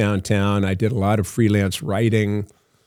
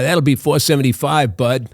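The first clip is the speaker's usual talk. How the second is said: higher, mock Brooklyn cabbie accent; 4 semitones higher than usual. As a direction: play the dubbed Brooklyn cabbie voice. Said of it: "Brooklyn accent say".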